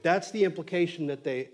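usual speaking voice

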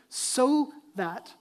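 "so that" slow and weighty; stress on "so that". Shown as a demonstration. Preacher pointing to the reason, "so that" stretched with emphasis. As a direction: draw out "so that" and pause after it. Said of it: "here's the reasoning".

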